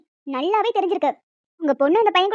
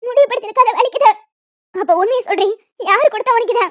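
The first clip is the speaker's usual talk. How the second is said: angry shouting; 3 semitones higher, 6 dB louder than usual. Acted. Mother keeps shouting.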